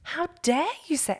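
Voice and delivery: indignant outburst, re-lived; retold anger. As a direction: fire back at the doctor with indignation. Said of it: "and I was so angry".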